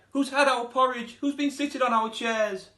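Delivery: high, loud indignant bear voices crying out; outraged bears. Acted. Bears finding their porridge eaten, indignant high cry. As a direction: cry out like three cross bears. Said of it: "who cried".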